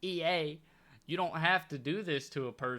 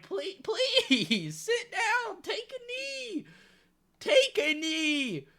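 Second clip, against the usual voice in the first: laughing plea; 13 semitones higher, 5 dB louder, much slower than usual. Real, not acted. Begging opponent to stop scoring.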